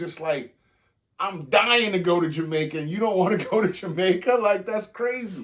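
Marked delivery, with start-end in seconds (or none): 1.2-5.4 high, loud incredulous rant; really worked up, can't believe it; loud disbelief. Real, not acted. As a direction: exclaim it at full voice, pitched up. Incredulous over refusing Jamaica trip.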